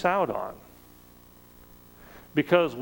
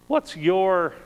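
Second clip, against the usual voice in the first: ordinary talk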